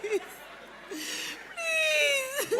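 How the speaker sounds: high, soft, drawn-out whimper; tearful begging. Acted. Woman begs man to stop, tearful begging. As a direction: whimper the plea, stretching it out.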